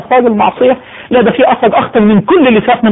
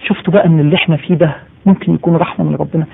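usual speaking voice

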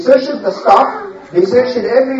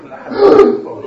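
gasp; 11 semitones higher than usual. Acted. Brothers remember the oath.

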